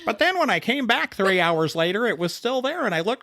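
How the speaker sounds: hammed-up true-crime narrator, giggling; true crime podcast voice. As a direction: play the stunned podcast witness. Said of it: "What is this voice you're doing?".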